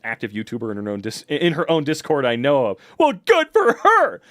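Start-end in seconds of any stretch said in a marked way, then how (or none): 3.0-4.2 high, loud, drawn-out sarcasm; mock congratulation. Streamer on a YouTuber with Wikipedia article, mock congratulation. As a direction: exclaim it with hammed-up resentment.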